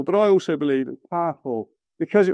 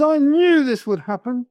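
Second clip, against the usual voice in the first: raised-pitch outburst of complaint; mock tantrum; 7 semitones higher than usual. Jonah complaining to God, throwing a tantrum. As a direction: play a mild tantrum, pitched high. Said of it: "Jonah throws a tantrum".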